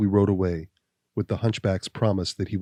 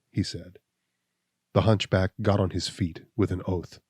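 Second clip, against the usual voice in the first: ordinary delivery, nothing marked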